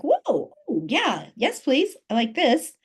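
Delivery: high, delighted gasp of wonder; excited awe. Retold or imitated. Coach reliving her vision garden, gushing high and delighted. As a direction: gush over the garden, pitched well up. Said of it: "It was like".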